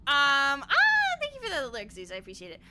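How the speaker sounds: normal talking voice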